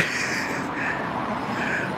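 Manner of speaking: soft voiceless laughter; real laugh. Real, not acted. Joker still amused by his joke, soft real laugh. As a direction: laugh quietly to yourself.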